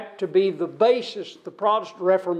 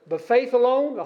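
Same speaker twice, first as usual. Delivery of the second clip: "faith alone" set apart, pitched up; stress on alone; 5 semitones higher than usual. Shown as a demonstration. Preacher to congregation, singling out faith alone. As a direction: point up "alone" with a lift. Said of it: "He added the word "alone".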